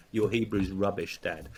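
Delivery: normal talking voice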